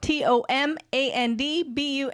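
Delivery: ordinary talk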